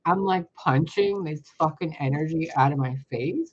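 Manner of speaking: ordinary talk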